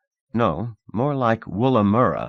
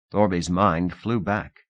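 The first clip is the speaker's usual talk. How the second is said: ordinary delivery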